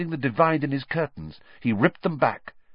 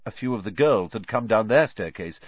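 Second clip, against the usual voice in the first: usual speaking voice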